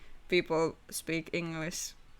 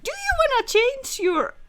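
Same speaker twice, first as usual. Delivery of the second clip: mock app voice; 6 dB louder than usual. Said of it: "Discord is always like".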